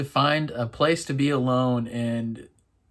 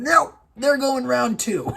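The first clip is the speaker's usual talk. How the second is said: excited disbelief; 11 semitones higher, 6 dB louder than usual. Real, not acted. Shocked they keep kissing.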